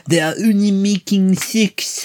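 slow, high, mock-moaning accent; mock moaning French accent. Host mimics the actress in the porn theatre, mock French moan. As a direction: ham up her offended moan. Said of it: "she's moaning".

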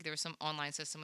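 ordinary delivery

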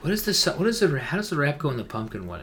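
usual speaking voice

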